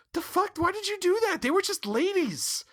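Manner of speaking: indignant protest. Retold or imitated. Cohost relaying Stevie's objection to the killing, high-pitched indignation. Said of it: "Stevie brought that up, too. It's like".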